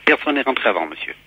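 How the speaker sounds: ordinary delivery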